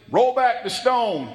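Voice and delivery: loud, slow command to the crowd; commanding call. Acted. Jesus commands at the tomb.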